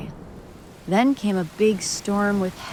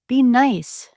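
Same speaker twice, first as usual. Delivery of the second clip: sharp, higher and louder rebuke; scolding; 6 semitones higher, 7 dB louder than usual. A narrator's reading. Mama Duck scolding her ducklings, high and loud. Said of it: "she would scold".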